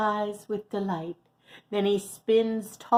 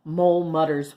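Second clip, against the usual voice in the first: ordinary delivery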